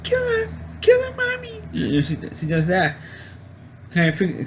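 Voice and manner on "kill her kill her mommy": put-on very high, whiny little-boy voice egging mommy on; child's voice. Killer mother mimics her drowned son urging her to kill, in a high child's voice. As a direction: squeak it out like a small child begging. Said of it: "speaking in a high pitch, like a child's voice".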